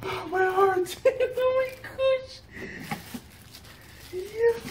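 high, choked-up gasp of emotion; overcome, near tears. Real, not acted. Opening his YouTube award, near tears. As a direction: voice cracking with feeling. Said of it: "Guys, I'm not crying".

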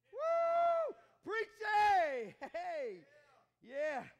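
high whooping cheer of "Preach, hey!"; excited whoop. Real, not acted. Church member whooping at the preacher, loud excited cheer. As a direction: shout your approval at the preacher.

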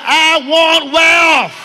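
slow, loud shouted declaration; preacher urging a timid crowd, shouting. Shown as a demonstration. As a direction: belt it out to the back row. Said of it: "Come on, shout".

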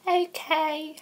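put-on high-pitched girly falsetto. Contestant [name] answering the host, girly voice. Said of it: "why did you do that girly voice".

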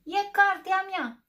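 slow, loud, high yell; yelling bear. Acted. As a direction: shout it out possessively. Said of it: "țipă ursul".

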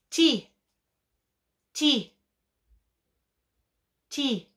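"Ti" said high and sharp, a clear t and long i. Shown as a demonstration. Teacher dictates the syllable ti to the class. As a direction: call out "Ti" crisply. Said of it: "syllable dictated".

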